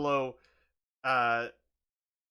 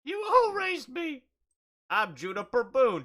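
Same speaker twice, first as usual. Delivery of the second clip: put-on small child voice; 10 semitones higher, 4 dB louder than usual. Pablo voice.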